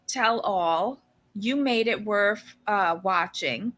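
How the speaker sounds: ordinary delivery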